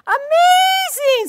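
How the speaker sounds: very high, loud, slow shout; big amazement. Shown as a demonstration. Judge shouts in amazement, very high and loud. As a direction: belt out amazing way up high. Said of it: "We did the high-low".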